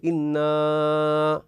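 إنا recited alone with a two-count alif, then stop; natural madd, pausing. Shown as a demonstration. Teacher showing the stop after إنا, slow. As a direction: say إنا and halt. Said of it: "أنا حينما أقول".

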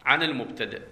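usual speaking voice

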